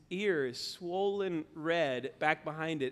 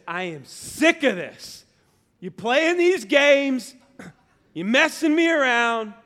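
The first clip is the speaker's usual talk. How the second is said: loud, slow, rising exasperated dad rant; mad; 8 semitones higher, 12 dB louder, slower than usual. Retold or imitated. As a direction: re-enact a fed-up father scolding his kid. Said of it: "I got mad".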